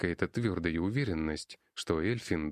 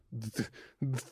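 higher, softer stutter in shock; stammering; 6 semitones higher, 4 dB quieter than usual. A narrator's reading. Shaken boy tries to answer Max, stammering. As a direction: barely get the sound out. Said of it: "Эльфин сделал судорожный вздох".

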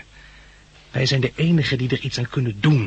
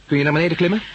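calling down; 6 semitones higher, 5 dB louder, much faster than usual. Acted. Calling down to her from above.

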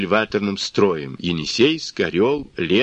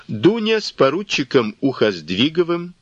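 plain reading voice

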